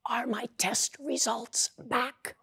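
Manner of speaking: high, slow, whispery patient; joke patient's voice. Acted. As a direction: draw the question out slowly in a high, thin voice. Patient repeats misheard question in punchline.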